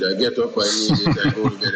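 ordinary talk, nothing marked

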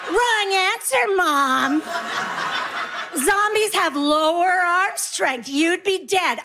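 lightly voiced know-it-all child, drawn out; scornful kid. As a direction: correct Mom like a scornful kid. Kids scornfully correcting mother.

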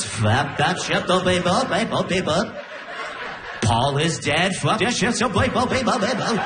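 warbling garbled gibberish; backwards record. Retold or imitated. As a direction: babble like a record spun in reverse. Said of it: "Sounds like you're turning a record backwards".

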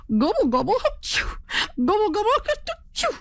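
high gobbles broken by sneezes; gobbling and sneezing. Acted. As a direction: dust makes the turkey sneeze mid-gobble. Turkey sneezing on dusty road.

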